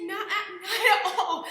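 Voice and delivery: laughing it off in relief. Retold or imitated. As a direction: laugh through the words, happily surprised. Surprised relief on phone call.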